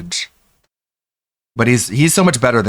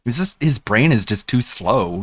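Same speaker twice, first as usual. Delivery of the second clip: ordinary talk, nothing marked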